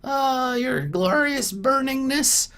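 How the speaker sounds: very high goblin voice, fawning; a nervous goblin sucking up to his new master; squeaky goblin. Acted. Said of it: "and is like".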